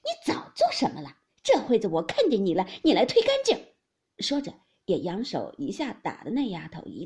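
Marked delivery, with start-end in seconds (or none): 0.0-3.6 angry scolding. A narrator's reading. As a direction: lash out at the servant, pitch leaping up. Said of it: "凤姐催道". (高声怒斥)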